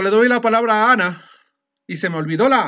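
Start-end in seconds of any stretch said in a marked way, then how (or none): 0.0-1.3 "palabra a Ana" blurred into "palabraana", the A swallowed; rushed, vowels run together. Shown as a demonstration. Teacher showing her own slip to the class, "palabra a Ana" rushed into one word. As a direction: rattle it off and drop the A in "a Ana". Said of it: "A veces digo muy rápido".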